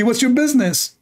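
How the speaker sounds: high-pitched indignant retort; mock outrage, pitched well up; imitated anger. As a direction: mimic the offended man telling you off. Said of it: "he got so angry from me".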